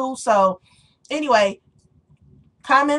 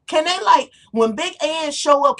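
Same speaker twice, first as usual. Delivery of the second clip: ordinary talk, nothing marked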